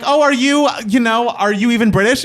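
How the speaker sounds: high, loud, incredulous question; a mock-amazed are you even British; teasing a fan with a bright, surprised question. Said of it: "And [name] was like".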